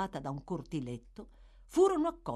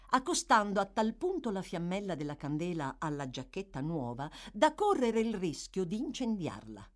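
usual speaking voice

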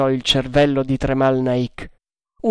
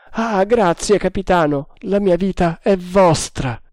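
higher-pitched, fervent thanks; fervent gratitude; 5 semitones higher than usual. A narrator's reading. Tremal Naik pledges his life to captain, fervent thanks. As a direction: pour out relief and devotion.

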